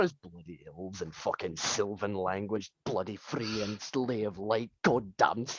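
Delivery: low, slow, hushed muttering; fed-up dwarf muttering to himself; grumbling dwarf walking off. Acted. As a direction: grumble curses deep under your breath as you leave. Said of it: "You just see him literally walking towards the door".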